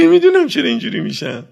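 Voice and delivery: high, loud bewildered outburst; loud helpless confession of a mourner; helpless cry. Retold or imitated. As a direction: cry out not knowing why.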